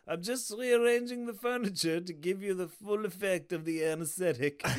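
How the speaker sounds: put-on French-accented bumbling detective; lightly voiced Clouseau, calm and innocent; Clouseau impression. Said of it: "He's like".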